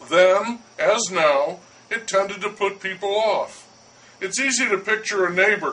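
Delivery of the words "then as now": ordinary talk, nothing marked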